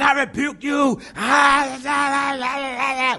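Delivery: high, loud mocking imitation of shouting prayer warriors; high mock shout of I rebuke you and babble; mock noisy prayer. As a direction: ham up a yelling deliverance prayer. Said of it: "praying all that noise".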